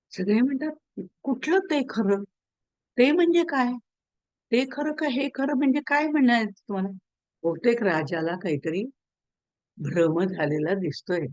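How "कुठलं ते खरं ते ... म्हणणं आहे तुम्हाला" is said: higher-pitched, puzzled retort; baffled questioning. Retold or imitated. Servants to the king about his odd question, baffled. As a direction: act out bewildered servants asking what is meant.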